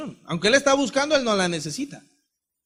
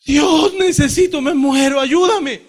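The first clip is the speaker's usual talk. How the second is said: high, loud cry to God, begging; desperate plea; 9 semitones higher, 7 dB louder, much slower than usual. Retold or imitated. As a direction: beg God at the top of your voice. Retelling his desperate plea to God.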